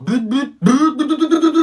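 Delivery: revving motorbike noises, high and loud; excited engine sputter building to a loud high burst; imitated motocross engine. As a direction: rev it up like a dirt bike, climbing in pitch. Imitating motocross wheelies in corridors.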